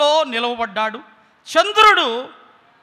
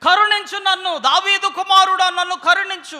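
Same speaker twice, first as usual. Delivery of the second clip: loud, high desperate plea to the master; a blind beggar's loud cry for help; crying out for mercy; 7 semitones higher, 7 dB louder than usual. Acted. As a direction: beg at the top of your voice. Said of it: "కేకలు వేశాడు".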